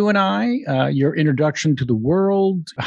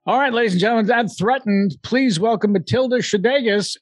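raised, showy emcee voice introducing the guest; emcee announcement; 8 semitones higher than usual. Acted. Podcast host introducing guest, grand emcee call. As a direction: announce her like a game show host.

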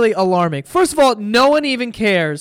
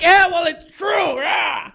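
mocking a supporter; 8 semitones higher, slower than usual. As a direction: shout it like a defensive fanboy. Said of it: "all his supporters are like".

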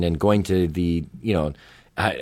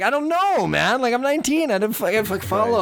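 much higher, pleading mock whine; flustered young self; 14 semitones higher than usual. Nineteen-year-old pleading with his boss, high and flustered. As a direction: play your teenage self squirming out of it.

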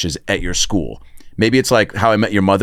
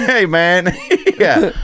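Theo Von voice; 9 semitones higher, much slower than usual. Pretend. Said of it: "do it as Theo Vaughn".

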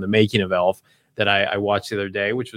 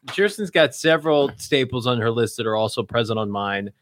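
ordinary delivery

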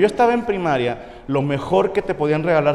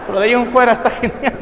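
ordinary talk

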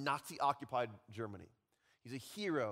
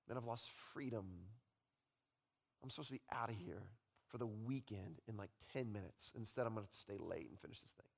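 much softer, low counting of losses; quiet rueful reflection; 5 semitones lower, 7 dB quieter than usual. Retold or imitated. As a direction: murmur the losses to yourself. Voicing reflective worker.

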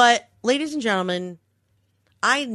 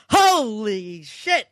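drawn-out, slow shocked curse; slow stunned; much slower than usual. Retold or imitated. Seeing the NPR card, a slow stunned curse. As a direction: let the shock sink in slowly. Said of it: "'Cause I just went".

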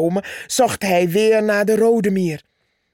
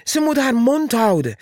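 angry snap; 6 semitones higher, faster than usual. Acted. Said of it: "antwoordde Purperhart".

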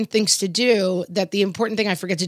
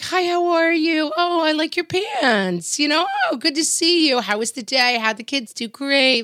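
bright, much higher, sing-song put-on friendliness; fake cheery small talk; 10 semitones higher than usual. Greeting people in town despite a horrible mood, fake cheerful. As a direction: gush pleasantries while secretly miserable. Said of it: "I am out and about during my day being like".

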